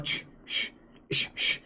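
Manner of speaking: unvoiced hushing shh; husband hushing his wife in a whisper; whispered shushing. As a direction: hush her like a nervous husband. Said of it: "her husband going".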